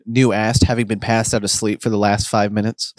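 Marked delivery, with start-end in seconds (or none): none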